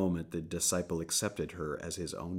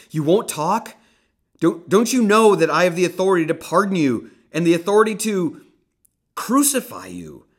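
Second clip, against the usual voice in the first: loud, much higher, fast and furious; angry outburst; 11 semitones higher, 14 dB louder than usual. Acted. Pilate berating the silent Jesus, fast angry shout. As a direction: rage at the silent prisoner.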